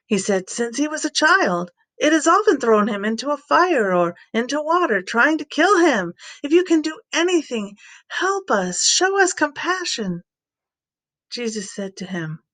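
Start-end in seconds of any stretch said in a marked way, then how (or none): 0.5-7.8 a father's higher, pleading voice, pitched up; desperate father. Acted. Father begging the teacher to heal his son, pleading high. Father describes son's fits. 8.1-10.2 a father's higher, pleading voice, pitched up; desperate father. Acted. Father begging the teacher to heal his son, pleading high.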